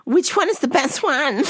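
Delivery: high mock customer voice; hammed-up high question. Customer asking the worker, high mock voice.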